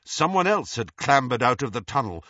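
plain reading voice

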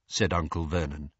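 plain reading voice

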